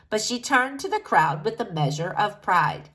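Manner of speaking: usual speaking voice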